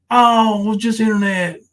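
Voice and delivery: high, loud mock of a dismissive excuse; mocking whiny quote. Mocking a rival who brushes it off as just internet, in a high sneering voice. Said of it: "come around here going".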